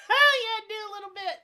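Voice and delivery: giggling through it; laughing while speaking. Real, not acted. Laughing request for impression.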